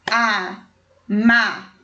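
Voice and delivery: "Amarelinhas" broken slowly into a-ma-re-li-nhas; slow syllable by syllable. Shown as a demonstration. Teacher counting syllables of "Amarelinhas" for pupils, slow and separated. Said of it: "Número de sílabas".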